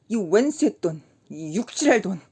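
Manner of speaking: loud, bitter curse at the money; bitter cursing. A narrator's reading. Kim cursing the money as he throws it, bitter and loud. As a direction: spit the words out.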